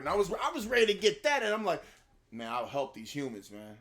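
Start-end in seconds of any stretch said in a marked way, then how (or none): 2.3-3.8 mock-casual, low and soft; underwhelming everyday-guy delivery; toned-down Thor. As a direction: imitate a hero with no grandeur, quietly offhand. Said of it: "very normal human-like voice".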